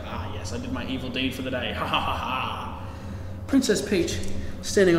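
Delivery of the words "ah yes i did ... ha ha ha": hint of a smug witch cackling; gloating villain. Maleficent pleased with her evil deed, mock evil laugh. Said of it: "She's like".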